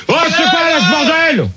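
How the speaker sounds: high-pitched irritated complaint; real fed-up host; exasperated outburst. Real, not acted. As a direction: protest at the mess on the line.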